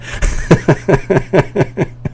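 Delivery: high, breathy giggle in a steady run of short bursts; amused staccato laughter; laughing. Real, not acted. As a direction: laugh in quick, even pulses at your own bad attempt. Laughs at own bad accent attempt.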